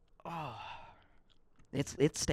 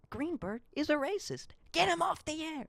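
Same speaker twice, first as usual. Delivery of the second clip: mock outrage. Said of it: "No, I'm kidding".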